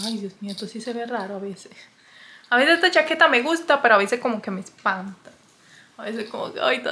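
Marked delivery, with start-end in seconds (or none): 0.1-1.7 whispered aside to the camera. Real, not acted. Whispering to viewers about her odd jacket. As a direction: drop to a whisper, half to herself.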